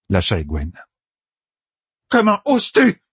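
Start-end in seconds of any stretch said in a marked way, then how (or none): 2.1-3.0 high-pitched, indignant cry; outraged shriek. A narrator's reading. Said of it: "s'égosilla l'usurpatrice". (cri aigu et indigné)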